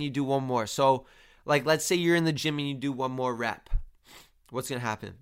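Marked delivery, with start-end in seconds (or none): none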